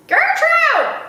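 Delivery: high, loud squawking parrot cry; parrot scream. Acted. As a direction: screech it like an irritating parrot. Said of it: "somebody screamed in a real irritating voice".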